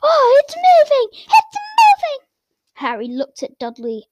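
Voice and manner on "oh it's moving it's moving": very high, loud, slow squeal; thrilled spoiled kid squealing; high-pitched excited yell; excited shriek. Acted.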